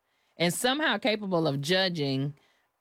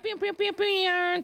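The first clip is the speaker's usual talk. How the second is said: pew pew sound effect; 13 semitones higher, slower than usual. Pretend.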